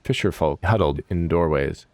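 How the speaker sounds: ordinary delivery, nothing marked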